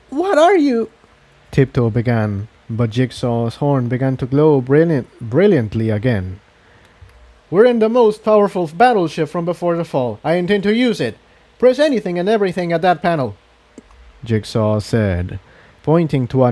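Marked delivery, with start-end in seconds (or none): 0.1-0.9 much higher voice, a startled pony; alarmed young pony. Acted. Tiptoe confronts Jigsaw, a high startled pony voice. 7.5-13.4 higher character voice, urgent orders; commanding Jigsaw. Acted. Jigsaw orders his friends at the panel, urgent and high.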